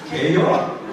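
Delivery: 개효 squeezed into one short Chungcheong word; Chungcheong dialect. Shown as a demonstration. Pastor showing how the whole question shrinks, Chungcheong 개효. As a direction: clip it down to 개효, grinning. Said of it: "다 축약버리고".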